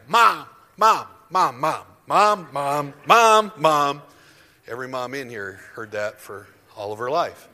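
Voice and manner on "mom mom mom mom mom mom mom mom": put-on loud, whiny child calling; nagging child. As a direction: keep calling for mom, insistent. Child pestering mother.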